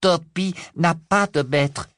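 high, slow proud elf voice; high, slow squeaky elf; shrill elf voice. A narrator's reading. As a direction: answer firmly, chin up. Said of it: "répliqua l'elfe d'une voix aiguë".

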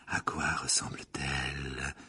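low, slow, breathy murmur. A narrator's reading. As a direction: draw the answer out in a murmur. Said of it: "murmura le professeur Trelawney".

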